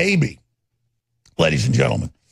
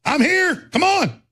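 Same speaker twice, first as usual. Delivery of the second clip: retold challenge; 11 semitones higher, slower than usual. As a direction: call them out, much higher. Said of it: "And I just said".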